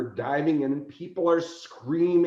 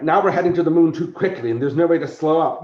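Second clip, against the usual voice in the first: fast, loud alarmed report; mock-panicked captain; urgent alarm; 4 semitones higher, 8 dB louder, faster than usual. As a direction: rush it out in panic. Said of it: "I'm like".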